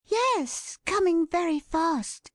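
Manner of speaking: put-on high, hushed young girl; blind girl voice. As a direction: answer quickly, young and tense. Bertha answers.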